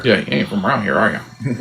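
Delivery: put-on drawled backwoods local accent; suspicious small-town local; yokel accent. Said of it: "somebody who's talking around like this".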